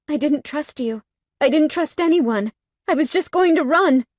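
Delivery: rising high and loud; defensive outburst. A narrator's reading. Heroine defending herself to the bikers, rising outburst. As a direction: plead your defence.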